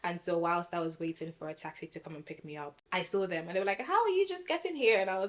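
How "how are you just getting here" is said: higher, a light imitation of surprised friends; mock surprise, pitched up. Friends spotting her at the taxi rank, surprised.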